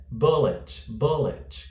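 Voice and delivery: short first vowel in "bullet"; correct pronunciation. Shown as a demonstration. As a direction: keep the oo of "bullet" lax. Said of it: "é bullet". ("bullet" com U curto)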